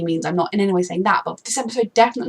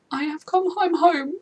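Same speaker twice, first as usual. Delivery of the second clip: swooning quote; 8 semitones higher, slower than usual. Retold or imitated. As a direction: sigh it out like a lovestruck fan. Quoting Michael's romantic line.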